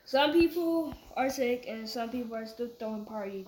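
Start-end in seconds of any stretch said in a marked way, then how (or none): 0.0-1.7 louder, higher and slowed down; speaking up. Real, not acted. Son repeating himself for mom, speaking up. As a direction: project it slowly after being told. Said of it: "you're not speaking up".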